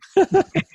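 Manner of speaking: loud high laugh bursting out; he cracks up at his own story; burst of laughter. Real, not acted. Laughing at the memory of yoga class, loud.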